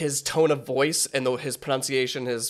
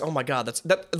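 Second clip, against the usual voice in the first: normal talking voice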